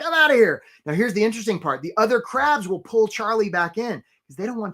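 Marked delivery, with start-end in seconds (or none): 0.0-0.6 high, loud cartoon crab calling out; a cocky crab waving goodbye to the boys; a crab bailing on the gang, high and loud. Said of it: "that's my crab voice".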